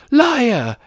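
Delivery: high-pitched outburst; sudden shout. A narrator's reading. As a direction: burst out with an accusing cry. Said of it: "Harry shouted suddenly".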